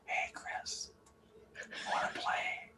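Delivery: breathy unvoiced whisper; whispering to a player instead of yelling. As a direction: hush it right down to nothing. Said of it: "I'll whisper".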